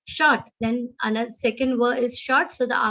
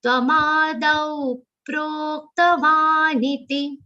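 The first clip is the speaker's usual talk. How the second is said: "proktavaniti" with a light push on "pa" after a long "dau"; subtle stress on pa; 3 semitones higher, slower than usual. Shown as a demonstration. Teacher modelling subtle stress in recitation. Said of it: "give a very subtle aghat of pa".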